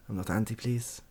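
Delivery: usual speaking voice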